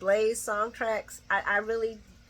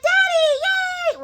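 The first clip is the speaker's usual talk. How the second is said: loud, high mock squeal; hammed-up gleeful greeting, pitched way up; excited daughter; 10 dB louder, much slower than usual. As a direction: squeal it like a thrilled little girl. Hammed-up daughter greeting her father.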